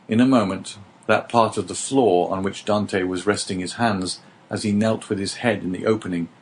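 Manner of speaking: plain reading voice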